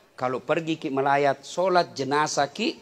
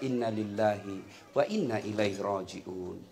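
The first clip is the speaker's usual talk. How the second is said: calm recitation; 8 semitones lower, 9 dB quieter than usual. Shown as a demonstration. Preacher shows mourners what to recite softly. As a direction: murmur it gently to calm grief. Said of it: "ucapkan saja".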